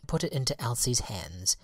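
ordinary delivery, nothing marked

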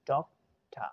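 "डॉक्टर" as DOK-tuh, R dropped; schwa ending. Shown as a demonstration. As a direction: teach "डॉक्टर" with a falling, open uh ending. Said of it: "देख रहे हैं मैं क्या बोल रहा".

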